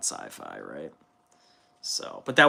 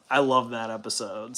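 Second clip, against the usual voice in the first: ordinary talk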